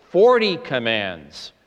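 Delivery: forty commands drawn out slowly, each word weighted; slow emphatic count. Real, not acted. Preacher hammering the count of commands to his congregation, slow and weighty. Drives home the number.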